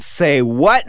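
ordinary talk

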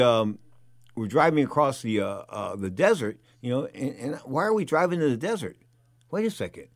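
ordinary talk, nothing marked

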